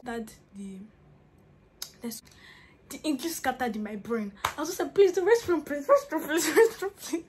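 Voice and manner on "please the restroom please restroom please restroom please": higher, louder desperate plea for the restroom; frantic begging. Retold or imitated. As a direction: beg over and over, panicking. Begging in broken English, desperate.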